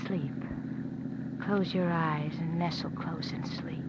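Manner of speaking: woman calming Joe until he sleeps; soothing. Acted. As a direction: hush him like a child. Woman soothing a frightened man.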